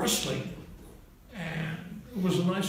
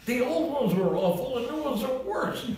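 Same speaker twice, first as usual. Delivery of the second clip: pitched higher, insisting hard; heated argument; 8 semitones higher than usual. Retold or imitated. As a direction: argue the point, voice jumping up. Had this terrible argument.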